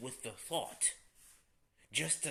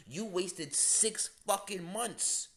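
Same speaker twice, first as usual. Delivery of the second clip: each word slow and pressed, higher; slow, stressed scold; 6 semitones higher, 3 dB louder, much slower than usual. Real, not acted. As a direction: lay it out word by word, exasperated. Scolding wasted time.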